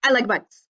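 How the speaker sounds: "I like bugs" rushed as one word, no pauses; too fast, no spaces between words; run together too fast. Shown as a demonstration. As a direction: squash the words together with no breaths. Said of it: "I would have to read it like this".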